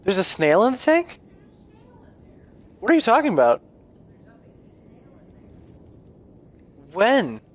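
high-pitched, incredulous questions; real astonishment. Real, not acted. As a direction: blurt it out in baffled disbelief, voice jumping up. Startled by unexpected snail in tank.

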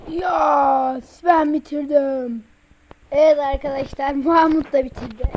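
loud game-show announcement; announcer voice. Acted. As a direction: call it out to the viewers. Announcing to audience.

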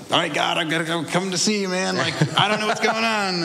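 high-pitched mock panic; retold freak-out. Man recalling his desperate call to God, high retold freak-out. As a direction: act out a frantic plea to God. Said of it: "'Cause I'm freaking out".

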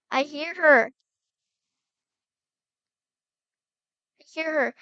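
loud, urgent exclamation; sudden play-acted burst of alarm; alarmed shout. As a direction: shout it out on hearing her. Hears the missing sister yelling.

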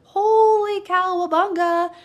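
hammed-up shocked wife; alarmed exclamation. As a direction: play Abigail startled that disaster is coming. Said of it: "Abigail's like".

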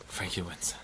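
hushed whisper of disbelief. Real, not acted. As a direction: breathe it out, barely voiced. Repeats the result twice.

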